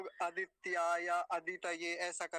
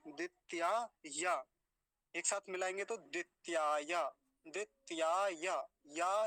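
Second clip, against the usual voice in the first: slow, broken into parts; slow chunked demo; slower than usual. Shown as a demonstration. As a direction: pace each chunk out for learners. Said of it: "ऐसे ऐसे तोड़ेंगे". (टुकड़ों में धीरे)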